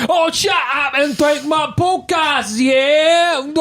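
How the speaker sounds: high, shouted put-on voice; hammed-up shouted meme voice; mock accent quote. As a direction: belt it in a silly put-on accent.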